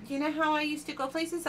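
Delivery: usual speaking voice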